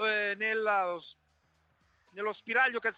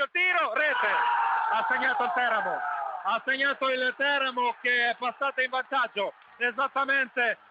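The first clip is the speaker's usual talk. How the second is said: breathless italian goal commentary. Real, not acted. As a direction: shout the goal as it happens. Goal scored, live radio call.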